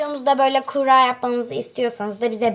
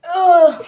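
gagging; 3 semitones higher, 7 dB louder than usual. Real, not acted. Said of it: "Ay, kusacağım".

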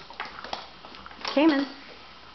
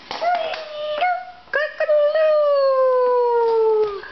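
very high, slow crowing rooster; 4 dB louder, much slower than usual. Shown as a demonstration. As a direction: crow it out long and high for a toddler. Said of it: "What sound does a rooster make?".

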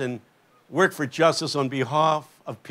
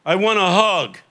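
higher-pitched, emphatic street man; street man's eager reply; 3 semitones higher, 4 dB louder, slower than usual. Retold or imitated. Street man asks preacher for a hug, emphatic reply. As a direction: answer up high and firm. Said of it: "He said".

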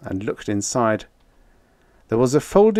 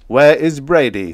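raised, louder and higher question; shouted demand; 5 semitones higher, 7 dB louder than usual. A narrator's reading. Brown shouting amid the confusion, demanding. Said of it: "shouted Brown".

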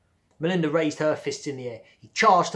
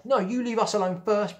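higher, louder mock yell back; childish retort; 8 semitones higher, 6 dB louder than usual. Bradley to the girls, a hammed-up shouted retort. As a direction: fire it back like a sulky kid.

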